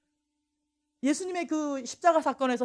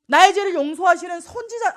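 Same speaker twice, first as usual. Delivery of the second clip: ordinary talk, nothing marked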